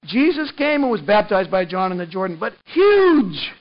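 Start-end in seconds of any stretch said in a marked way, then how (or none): 2.7-3.5 emphatic. Real, not acted. Stressing how huge it was.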